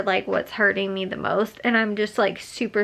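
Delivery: usual speaking voice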